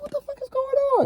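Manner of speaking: very high, fast, squeaky disbelief; high, rapid what-the-fuck; stunned outrage. Retold or imitated. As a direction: blurt it out in shock. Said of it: "I'm like".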